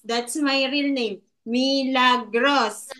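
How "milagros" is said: "Milagros" slowly split into three beats; syllable by syllable. Shown as a demonstration. Teacher giving real name as example, "Milagros" slowly by syllables. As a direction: sound out "Milagros" one syllable at a time. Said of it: "So that's three syllables".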